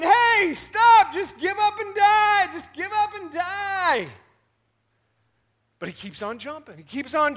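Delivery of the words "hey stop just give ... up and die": very high, loud yell to stop. Acted. Frogs at the rim yelling down at the jumping frog, high and loud. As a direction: holler down the hole to give up.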